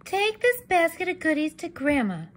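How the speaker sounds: ordinary delivery, nothing marked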